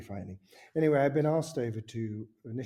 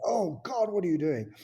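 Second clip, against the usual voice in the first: mock-appalled, raised voice; shocked exclamation; 7 semitones higher, 5 dB louder than usual. Director finding the actors in the jacuzzi, appalled. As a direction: exclaim it as if catching them at it.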